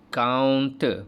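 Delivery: ulang "Counter" dengan jelas; modelled repeat. Shown as a demonstration. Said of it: "Boleh ulang?".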